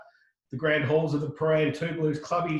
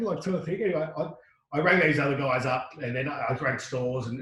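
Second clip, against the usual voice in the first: ordinary talk, nothing marked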